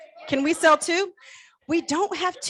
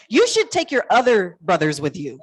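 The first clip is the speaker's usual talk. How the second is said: normal talking voice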